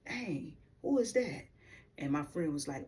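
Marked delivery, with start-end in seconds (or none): 0.1-1.4 higher, impressed call-out at a pretty woman; impressed exclamation. Retold or imitated. Young man spotting a pretty lady, impressed exclamation. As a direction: let it jump up in admiration. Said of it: "I said".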